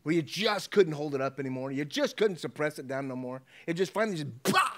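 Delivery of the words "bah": loud mock blow-up cry; acted eruption of held-in anger; explosive outburst. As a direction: burst out with a sudden yell. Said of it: "Have you ever blown up?".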